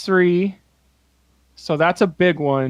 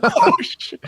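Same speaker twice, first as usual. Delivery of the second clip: much higher, squeaked disbelief; squeaky oh shit. Retold or imitated. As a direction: squeak it out in comic shock. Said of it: "and I was like".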